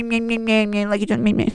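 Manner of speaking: mocking, bleated babble; whiny brat. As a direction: imitate a whining little brat. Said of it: "whiny little brat".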